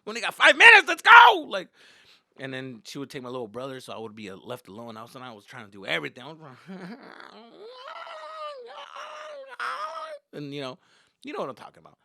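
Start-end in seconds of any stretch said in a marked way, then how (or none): 0.0-1.5 acted urgent shout. Acted urgent hurrying of girlfriend. 6.4-10.3 long, very high-pitched cry; mock squeal, held and drawn out; imitated high wail. Imitated noise of frantic activity.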